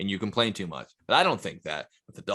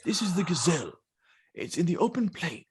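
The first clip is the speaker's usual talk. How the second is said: David Attenborough; 8 semitones higher than usual. Retold or imitated. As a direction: hush it like a wildlife film. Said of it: "the guy who does the voice. And he goes".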